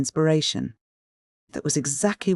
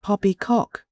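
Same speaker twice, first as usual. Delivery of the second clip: a high scornful snort of a word; 7 semitones higher, slower than usual. A narrator's reading. Uncle Benjamin dismissing Georgiana's Shakespeare, snorting. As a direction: scoff it away. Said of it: "snorted Uncle Benjamin".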